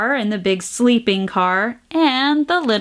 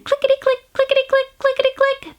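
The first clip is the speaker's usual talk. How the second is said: high, tiny clickety-click; squeaky toy-train chant; little train voice; 13 semitones higher, faster than usual. A narrator's reading. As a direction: make it sound like a tiny train.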